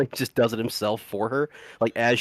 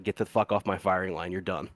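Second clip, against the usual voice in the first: retold order, low-key and trailing lower; calm dismissal; 3 semitones lower, 4 dB quieter than usual. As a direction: dismiss them without raising your voice. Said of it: "Like, all calm".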